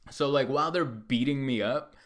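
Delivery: usual speaking voice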